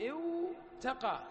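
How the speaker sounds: اوتقى drawn out slowly with a clear و before the ت; slow underlying form. Shown as a demonstration. Teacher showing students the original اوتقى. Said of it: "تقول اوتقى".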